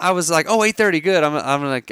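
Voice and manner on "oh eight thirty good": eager and bright, pitched up; happy anticipation; excited. Retold or imitated. Mark retells his excitement.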